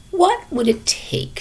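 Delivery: grandma asking. Acted.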